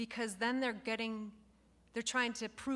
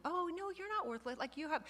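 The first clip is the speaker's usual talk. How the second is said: mock cheerleader reassurance; 7 semitones higher, 4 dB quieter than usual.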